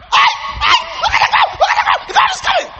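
panicked shout. Acted. Young eagle thrown from the nest, yelling in panic. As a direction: cry out as if plummeting to earth.

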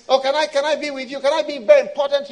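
high, loud, fast eager begging; pleading believer. Acted. Believer begging God for closeness, pleading fast. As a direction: rush out the plea, desperate to be wanted.

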